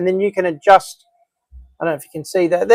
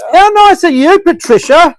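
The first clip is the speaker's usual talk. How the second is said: sing-song high and loud; high gushing; 10 semitones higher, 4 dB louder than usual. Acted. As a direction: gush it back, way up high. Echoing the gushing thanks.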